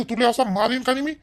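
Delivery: ordinary talk, nothing marked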